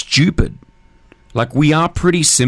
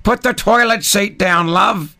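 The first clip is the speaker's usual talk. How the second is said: higher-pitched mock nag; mock nagging partner; 8 semitones higher than usual. Mocking the toilet seat cliché, high put-on nag.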